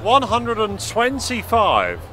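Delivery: drawn-out score call; darts score caller. Real, not acted. Referee calling scores.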